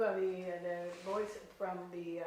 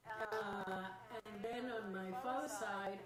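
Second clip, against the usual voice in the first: ordinary delivery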